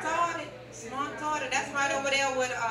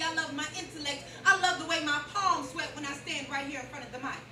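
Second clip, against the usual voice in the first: usual speaking voice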